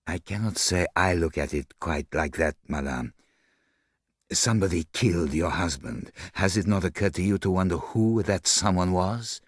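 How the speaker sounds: put-on restrained, lightly accented reproof; measured foreign detective. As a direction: question her with polite gravity.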